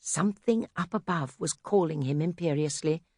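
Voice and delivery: ordinary delivery, nothing marked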